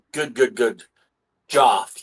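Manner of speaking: usual speaking voice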